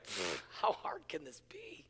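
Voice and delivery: very soft, dismissive puff and aside; mock cocky scoff. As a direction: toss it off under your breath, acting unbothered. Acting cocky teen before hill test.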